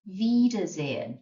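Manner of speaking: "Wiedersehen" said slowly with a V at the start; slow model. Shown as a demonstration.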